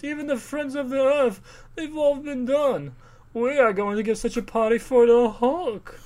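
high, slow, grandiose society voice; mock upper-class father; pompous rich man. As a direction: ham up a pompous gent announcing a party. Voicing rich father again.

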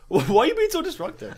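hoch und spöttisch nachgeäfft; mock Guardian. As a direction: make him sound whiny and high, mocking. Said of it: "He's like".

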